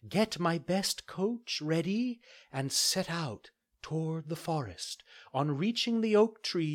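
high, gentle fairy voice; a kind fairy giving a calm order. Acted.

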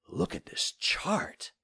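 slow awed whisper. Acted. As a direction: whisper it slowly. Father awed at old chart.

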